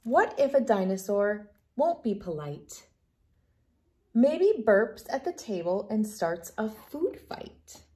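ordinary delivery, nothing marked

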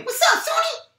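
playful squeak. Acted. Rocky teases his brother, silly high.

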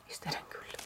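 soft breathy whisper, leaning in close. Real, not acted. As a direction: whisper it like a little secret about the cute cat. Cooing over cute cat stamp.

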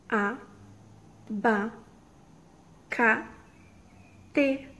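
"A, ba, ca, te" split into separate beats with pauses; counting syllables for kids. Shown as a demonstration. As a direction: tap out each syllable of "abacate" slowly. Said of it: "Eu vou levantar um dedo pra cada sílaba".

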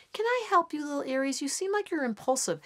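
a lightly voiced sugary Cancer; sweet Cancer. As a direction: talk down kindly to little Aries. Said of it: "And then Cancer goes".

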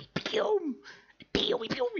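"pium" agudísimo en falsete; efectos de sonido imitados. Retold or imitated. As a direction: imita disparos con la voz muy aguda. Imita los disparos del tráiler. (onomatopeya juguetona y chillona)

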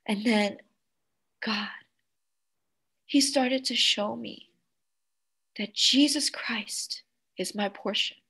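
soft near-whisper; hushed reverence. Real, not acted. Pastor revealing Jesus as her portion, whispered. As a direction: drop to a whisper for the key truth.

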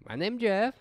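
put-on high-pitched comic Mexican accent; movie Mexican accent. Quoting Twenty-two Jump Street, comic Mexican accent. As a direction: quote the film in a Mexican accent, amused. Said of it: "the movie Twenty-two Jump Street, where he's like".

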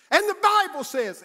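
high, loud pulpit cry; imitated preacher at full pitch; wound-up young preacher. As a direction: shout it like an overexcited preacher. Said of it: "He got all wound up".

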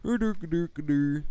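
put-on doofus mumbo; mock dopey voice. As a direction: babble like a dolt, jokingly. Mocking noise, then kidding.

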